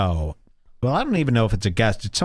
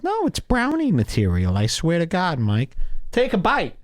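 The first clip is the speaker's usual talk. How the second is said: high, insistent mock host; mock-earnest coaxing, voice jumping high; Keith; 5 semitones higher than usual. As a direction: insist in a pitched-up put-on voice. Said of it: "He goes".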